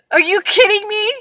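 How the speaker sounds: high-pitched rising disbelief; incredulous. Retold or imitated. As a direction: act out sheer disbelief, pitch climbing. Retold disbelief at agent.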